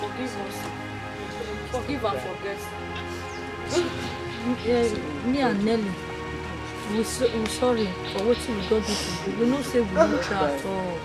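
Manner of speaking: soft, low begging for forgiveness; children begging their mother's forgiveness, softly; quiet pleading. Acted. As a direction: beg her softly over the music. Pleading apology over music.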